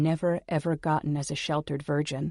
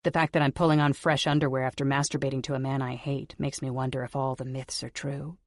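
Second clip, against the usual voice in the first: ordinary delivery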